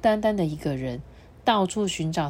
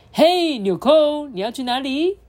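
toy car voice; 7 semitones higher, 5 dB louder than usual. Acted. Toy car greets button.

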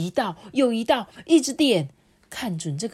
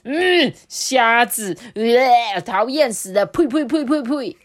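high, loud disgusted pot voice; pot spitting food; 5 semitones higher, 8 dB louder, slower than usual. Acted. Pot spitting out shrimp in loud disgust.

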